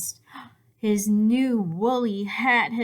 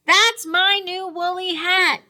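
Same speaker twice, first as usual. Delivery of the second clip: high, loud wail of protest; upset cry; 12 semitones higher, 9 dB louder than usual. A narrator's reading. Douglas protests to Rabbit about his hat, loud high cry. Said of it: "cried Douglas".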